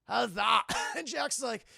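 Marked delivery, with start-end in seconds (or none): 0.1-0.6 loud, effortful Scottish taunt; mock worn-out bluster; exhausted Scotsman. Tired Scotsman after his feeble blow, exhausted taunt. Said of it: "they're just so fucking tired".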